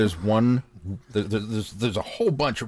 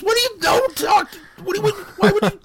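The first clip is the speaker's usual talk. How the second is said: shrieking much higher and louder, horrified; panicked shriek; 7 dB louder than usual. Retold or imitated. Rachel shrieking at Joey over a spoiler, panicked squeal. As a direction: squeal in alarm as if the twist was just spoiled. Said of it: "She goes".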